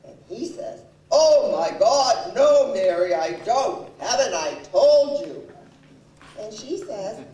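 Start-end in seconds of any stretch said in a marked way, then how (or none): 1.1-5.5 mimicked husband at the end of his rope; acted exasperated husband. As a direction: play his heavy, emphatic denial. Voices husband's exasperated denial.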